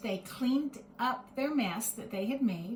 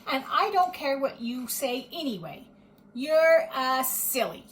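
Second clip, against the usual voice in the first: rude talk-back; 4 semitones higher, 8 dB louder than usual. A narrator's reading. Edith talks back rudely.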